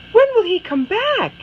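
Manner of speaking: Anna asks her mother again, pleading. Acted. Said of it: "she asks her mother".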